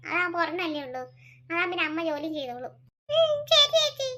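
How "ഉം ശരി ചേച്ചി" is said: put-on high, small child's voice; little boy voice. Young son answering his mother, high childlike voice. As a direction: play the young son, eager and sweet.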